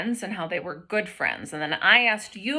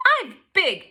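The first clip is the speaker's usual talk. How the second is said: boastful truck; 9 semitones higher, 4 dB louder than usual. Acted. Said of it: "yelled a dump truck".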